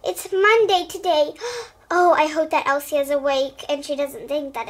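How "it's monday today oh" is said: plain reading voice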